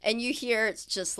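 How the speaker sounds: usual speaking voice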